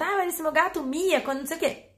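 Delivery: lightly voiced owner asking a question; imitating an asking owner. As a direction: mimic a pet owner asking about her cat. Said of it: "as pessoas me perguntam direto".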